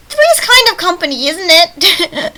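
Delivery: loud, high-pitched playful delivery; playful exclamation. Acted. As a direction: belt it out high and jokey. Reads game choice aloud playfully.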